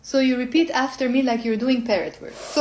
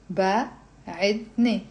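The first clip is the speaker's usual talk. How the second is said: "بعدني" drawn out slowly in a lower pitch; slow teaching pace; 4 semitones lower, slower than usual. Shown as a demonstration. Teacher sounding out a word for learners, very slow. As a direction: break "بعدني" into slow syllables. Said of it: "we're reading it slowly and we're explaining".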